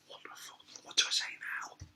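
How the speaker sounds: whispered inner thought; acted helpless whisper under the breath; panicked aside. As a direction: mutter it voicelessly to yourself. Visitor's panicked thought to himself.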